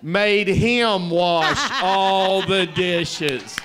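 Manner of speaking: high, slow, laughing boss brag; smug manager. Retold or imitated. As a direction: gloat like a sly manager. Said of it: "He goes".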